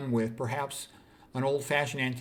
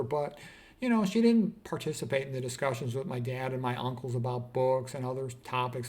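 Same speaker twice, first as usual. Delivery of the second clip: usual speaking voice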